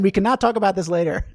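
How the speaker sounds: loud, joking refusal breaking into laughter; mock insistence. Real, not acted. Teacher teasing viewer Ken about talking later, loud mock insistence. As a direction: raise your voice in playful protest.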